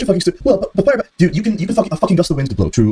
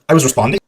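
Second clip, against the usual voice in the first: mocking rise at the end of a plain statement; imitating an upward inflection on "I was responding"; mock uptalk; 3 semitones higher than usual. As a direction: parrot "responding" with a question-like lift. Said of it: "they did the Aiden noise".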